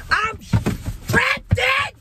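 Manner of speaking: drawn-out high excited shout; hyped shout. Real, not acted. Vlogger hyping the matchup, shouting as he straps in. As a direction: yell it like a ride is about to launch.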